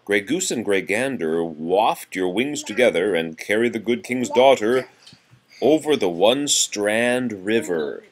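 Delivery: lively sing-song nursery rhyme reading; reading to children. Acted. Parent reading seasons rhyme to a toddler, sing-song. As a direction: read it bouncy for a small child.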